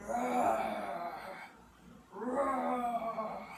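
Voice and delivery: hammed-up dragon roar for the kids. Shown as a demonstration. Teacher showing kids dragon noises, mock roar. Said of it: "we're gonna make dragon noises".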